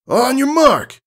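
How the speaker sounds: high, loud starter's call. Acted. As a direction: shout it across the field. Starter calls the race.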